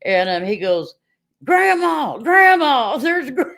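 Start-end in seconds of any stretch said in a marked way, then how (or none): 1.4-3.5 high, loud call of Grandma across the store; a boy calling his grandma, high and loud; excited child calling. Retold or imitated. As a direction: call out like a thrilled little boy.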